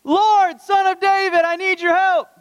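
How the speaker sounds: begging woman crying out to Jesus, loud and high; desperate screaming plea. Acted. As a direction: cry out for help at the top of your voice. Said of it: "Would you keep screaming".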